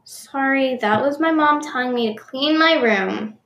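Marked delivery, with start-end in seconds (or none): none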